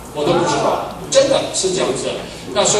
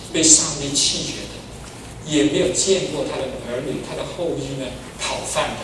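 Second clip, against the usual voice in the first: quiet scripture quote; 5 semitones lower, 7 dB quieter than usual. Retold or imitated. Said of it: "他讲说".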